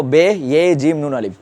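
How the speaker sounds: বে ইয়ে জিম নুন আলিফ, no separate he; বে ইয়ে জিম নুন আলিফ with he folded into the first letter; correct spelling-out. Shown as a demonstration. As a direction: spell it as bhe, ye, jim, nun, alif. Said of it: "আমরা বলবো".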